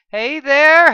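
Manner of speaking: high, loud calling out; excited greeting. A narrator's reading. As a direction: bounce in shouting hello.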